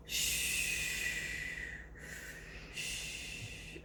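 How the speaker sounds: soft whispered shh; hushing whisper. Acted. Shushing viewers so the dog can sleep, whispered. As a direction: hush the listeners, don't wake him.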